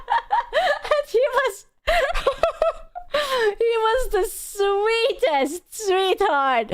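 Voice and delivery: real laughter breaking through slow words; talking slowly through real laughter; laughing. Real, not acted. Laughing while telling the story.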